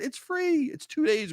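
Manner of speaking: very high, gushing sales hype; excited pitch. Real, not acted. To listeners about the free event, very high excited hype. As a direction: sell it hard with glee.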